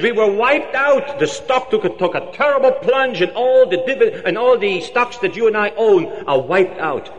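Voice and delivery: panicked news. Acted. Partner reports stock crash.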